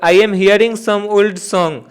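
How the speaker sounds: slow, word by word reading; dictation pace. Shown as a demonstration. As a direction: read the sentence out slowly. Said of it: "reads question aloud slowly". (धीमी गति से वाक्य पढ़ना)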